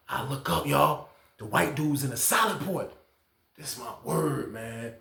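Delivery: ordinary talk, nothing marked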